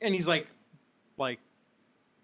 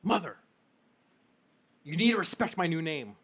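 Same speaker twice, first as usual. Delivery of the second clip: man's voice; 3 semitones higher, 7 dB louder than usual. Acted. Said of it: "you hear a man's voice saying".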